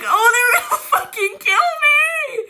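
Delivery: high, drawn-out mock-crying shriek; panicked wail. Storyteller acting out fear of gunmen, high panicked wail. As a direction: wail it loud and long in hammed-up terror. Acts out panic at being killed.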